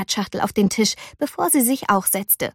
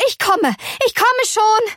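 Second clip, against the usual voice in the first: hurried mother; 12 semitones higher than usual. A narrator's reading. Mother hurrying in.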